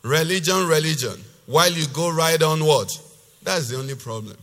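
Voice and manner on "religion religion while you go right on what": loud, emphatic preacher's reading; forceful scripture reading. Acted. Preacher reading God's rebuke to the congregation, loud and emphatic. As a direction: declaim God's rebuke with hard-hit key words.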